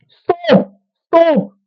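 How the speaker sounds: high panicked cries of stop; panicked screams. Retold or imitated. Woman sparring with a man, shrieking high in panic. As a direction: scream it like you've just been hit hard.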